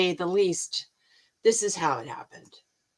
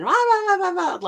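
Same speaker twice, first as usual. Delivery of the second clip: blah jumps way higher, tossed off; mocking chatter; 11 semitones higher, 4 dB louder than usual. Real, not acted. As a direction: brush off the chatter. Mocking small talk.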